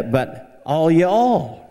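"all y'all" very slow and high; slowly drawled Southern "all y'all". Shown as a demonstration. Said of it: "plural of y'all".

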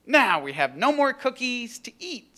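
high, mock-mournful storybook voice; hammed-up disappointment; sad. A narrator's reading. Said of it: "said Toad sadly".

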